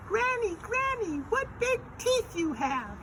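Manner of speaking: put-on very high squeaky child voice. Shown as a demonstration. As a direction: demonstrate the line in a loud falsetto.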